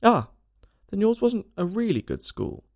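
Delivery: an "ah" of relief, then lifted pitch; relieved. A narrator's reading. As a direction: let the relief lift the voice. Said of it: "in a tone of relief".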